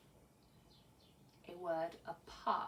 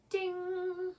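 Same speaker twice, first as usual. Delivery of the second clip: high sung "ding" bell; 10 semitones higher, 3 dB louder than usual. Shown as a demonstration. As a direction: chime it like a bell. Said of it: "ding if it rhymes".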